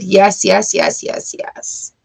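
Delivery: yes, yes, trailing into a breath; fading to a whisper. Real, not acted. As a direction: let each yes fade until it is only breath.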